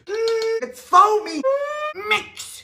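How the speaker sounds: high, slow squeal of amazement; amazed squeal. Real, not acted. Host marvels at the foaming pan, high and slow. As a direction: stretch it out, delighted and squeaky.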